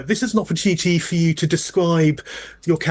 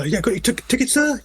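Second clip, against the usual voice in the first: polite conductor; 10 semitones higher than usual. Acted.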